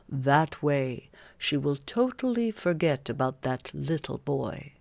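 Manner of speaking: a hint of a sly fairy king, low; King Oberon. A narrator's reading. Narrator voices King Oberon.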